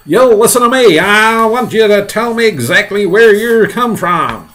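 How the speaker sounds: high, loud, commanding shout; shouting through cupped hands. Acted. Police chief questioning the centipede, hollered demand. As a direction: holler the demand up to someone far off. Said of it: "cupping his hands to his mouth".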